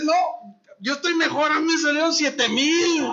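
mock boasting neighbor.

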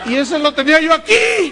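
high, loud laughing exclamation; laughing outcry. Retold or imitated. Preacher recalls the horn at his ear, loud and laughing. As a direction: cry out in amused outrage. Horn blown right at him.

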